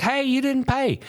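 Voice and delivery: mock yell. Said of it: "I was like".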